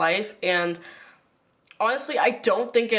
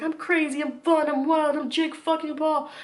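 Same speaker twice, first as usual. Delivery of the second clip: put-on higher, wild party-boy bravado; Jake Paul hype; 8 semitones higher than usual. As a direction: brag like a hyped-up YouTuber. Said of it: "Jake has always come across as".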